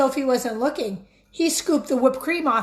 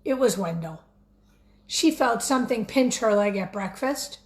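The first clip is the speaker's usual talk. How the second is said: plain reading voice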